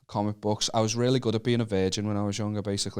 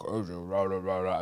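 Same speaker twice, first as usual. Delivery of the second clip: mocking audiobook narrator; 5 semitones lower, 5 dB quieter than usual. A mate mocks a Game of Thrones audiobook reader, droning lower. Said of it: "read in shit voices".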